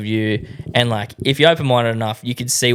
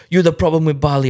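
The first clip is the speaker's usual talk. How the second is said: higher-pitched mock accusation; higher, put-on complaining voice; retold accusing driver; 5 semitones higher, faster than usual. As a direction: act out an indignant driver. Retold haggling quarrel with drivers.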